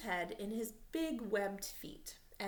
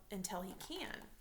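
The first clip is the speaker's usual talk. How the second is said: ordinary delivery, nothing marked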